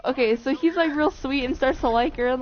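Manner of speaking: normal talking voice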